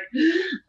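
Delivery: a sharp high gasp on seeing him; starstruck gasp. Retold or imitated. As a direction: gasp as if a star just walked in. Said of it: "I was like".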